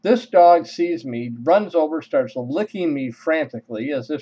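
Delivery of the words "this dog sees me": usual speaking voice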